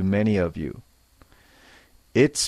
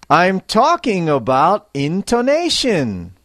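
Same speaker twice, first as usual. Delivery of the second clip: higher, louder, with life and feeling; lively intonation; 5 semitones higher, 3 dB louder than usual. Shown as a demonstration. Teacher demonstrating to learners, with lively feeling. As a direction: put feeling into the line. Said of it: "the life and feeling in your voice".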